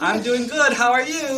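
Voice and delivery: high, loud put-on character voice; talking rock voice. Crocodile posing as a magic rock, in a put-on voice.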